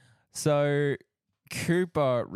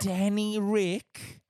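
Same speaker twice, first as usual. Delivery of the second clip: slow drawn-out name; 8 semitones higher, much slower than usual. Shown as a demonstration. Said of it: "sounds like a mob enforcer on a soap opera".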